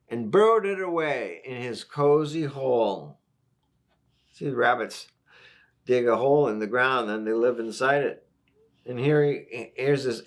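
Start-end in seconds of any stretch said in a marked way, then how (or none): none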